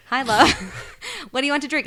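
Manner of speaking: high, loud, laughing Irish bartender voice; Irish barman accent. Retold or imitated. Irish accent as bartender Finn greets Cassie. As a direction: attempt an Irish brogue, giggling. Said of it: "Do the accents".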